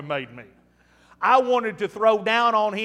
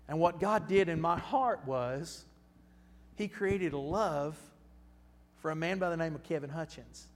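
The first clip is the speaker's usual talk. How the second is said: tender testimony; 5 semitones lower, 10 dB quieter than usual. Real, not acted. Preacher telling the congregation how God changed his heart, softly. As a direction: let it land gently.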